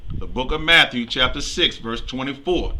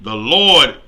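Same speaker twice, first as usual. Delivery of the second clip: loud, measured Bible reading; scripture reading; 5 dB louder than usual. Acted. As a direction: proclaim the verse to the congregation. Reads scripture aloud.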